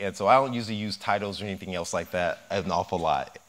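usual speaking voice

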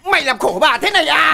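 loud mock-reproachful wail; imitated wife's cursing. Husband acts out his labouring wife's complaint, loud wailing. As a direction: mimic a suffering wife berating her husband. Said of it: "À, nó chửi là".

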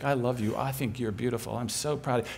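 a hint of a soft, gentle voice of love; tender loving voice. God's voice telling her she is loved, tender. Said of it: "that beautiful voice".

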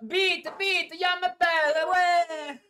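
loud, bossy fake German accent. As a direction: bark orders in German accent. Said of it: "adesso inizia a fare pure tedesco".

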